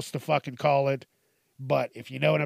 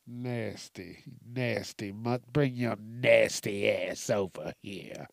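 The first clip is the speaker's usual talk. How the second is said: low, slow dirty-talk parody; mock dirty talk; 4 semitones lower, 5 dB quieter, slower than usual. Joker showing off his bad dirty talk, mock seduction. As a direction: drop low and draw it out, hammed-up seduction. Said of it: "I'll be like".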